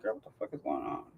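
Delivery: hushed, voiceless aside, quickly; retold whisper. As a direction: whisper it fast, acting out the alarm. Retold reaction to approaching flashlight.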